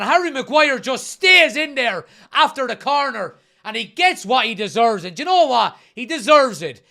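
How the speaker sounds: excited. Real, not acted. After the goal, still elated.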